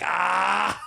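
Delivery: high excited yelp of delight; a sudden squeal, hammed up. Retold friend squeals at the Philly joke.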